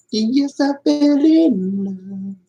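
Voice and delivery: high slow lovestruck sigh; hammed-up swoon. Streamer hams up falling in love with lavender, high slow swoon. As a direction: swoon dramatically.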